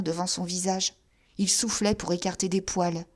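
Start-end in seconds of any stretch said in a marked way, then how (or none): none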